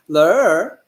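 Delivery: "Ler" drawn out with a sing-song wave. Shown as a demonstration. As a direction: let the pitch of "Ler" swing as if sung. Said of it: "had the sing-song tone".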